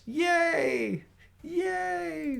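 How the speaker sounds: very high mock cheer; sarcastic cheer. Mocking government spending, high sarcastic cheer. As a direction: squeal a fake hooray. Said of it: "I'm being sarcastic".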